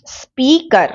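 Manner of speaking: स्पीकर drawn into two beats, स्पी and कर; syllable by syllable. Shown as a demonstration. Teacher breaking down a word, स्पीकर syllable by syllable. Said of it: "दो हो गए".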